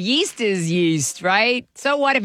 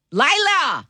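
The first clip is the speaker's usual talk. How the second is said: calling out; 7 semitones higher than usual. Real, not acted. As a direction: call her name, pitched high. Host calls on the caller.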